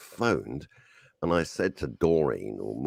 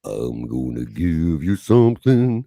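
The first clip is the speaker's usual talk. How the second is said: slow, low and louder croon; hoarse deep soul-singer boom; deep Motown voice; 9 dB louder than usual. Pretend. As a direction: rumble it like a Motown bass singer. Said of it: "the bass line of a Motown band".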